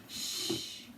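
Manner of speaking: soft, voiceless shushing; whispered hush. A narrator's reading. James silencing the wailing Earthworm, whispered. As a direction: hush them under the breath. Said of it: "whispered James".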